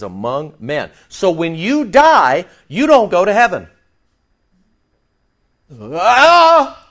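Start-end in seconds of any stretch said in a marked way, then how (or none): 1.1-3.7 forceful preaching. Real, not acted. Preaching climax. 5.8-6.8 high loud yelp of excitement; excited shout. Real, not acted. Shouted exclamation mid-sermon.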